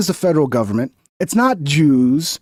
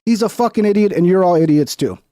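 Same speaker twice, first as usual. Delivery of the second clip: ordinary talk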